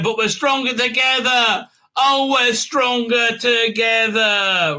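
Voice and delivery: high, drawn-out sappy slogan; hammed-up rallying cry; mock-inspirational. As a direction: declaim it with stretched-out fake uplift. Mocking a rousing group slogan.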